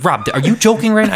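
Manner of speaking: incredulous yelp, voice shooting up; imitating angry dad. Dad angry over fake invoice.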